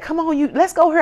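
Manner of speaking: high, eager coaxing; high urging friends. Retold or imitated. Friends begging her to come out, high and eager. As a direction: pitch it up like pushy friends.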